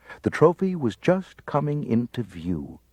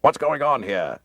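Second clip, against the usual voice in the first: demanding; 5 semitones higher, 3 dB louder than usual. A narrator's reading. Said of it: "he demanded".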